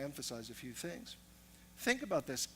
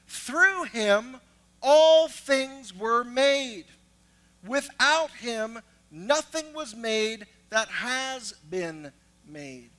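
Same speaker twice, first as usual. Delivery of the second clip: drawn out, booming emphasis; slow, loud proclamation of every word; scripture read slow and loud; 6 semitones higher, 13 dB louder, much slower than usual. Acted. Preacher to congregation, slow booming scripture reading.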